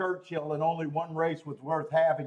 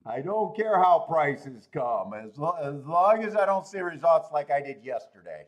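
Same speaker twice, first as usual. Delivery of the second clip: mock prayer; 6 semitones lower than usual. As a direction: beg the heavens half-jokingly. Praying to the horse racing gods.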